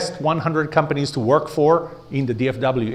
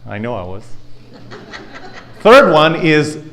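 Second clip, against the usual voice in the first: ordinary delivery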